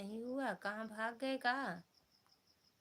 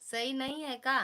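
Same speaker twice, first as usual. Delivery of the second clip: loud, high pleading ask; loud question; 4 semitones higher, 9 dB louder than usual. Real, not acted. Asking viewers if the new glasses look okay, loud and high. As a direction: call the question out loudly to viewers.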